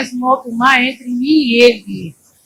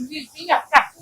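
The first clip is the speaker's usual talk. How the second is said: normal talking voice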